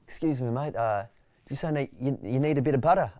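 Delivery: calling out. Retold or imitated. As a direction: call out helpfully across a packed tram. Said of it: "he would call out".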